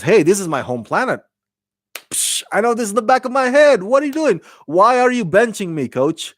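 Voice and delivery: quick, high-pitched mock protest; Saru protesting. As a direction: play the offended local who wants in. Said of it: "But Saru says".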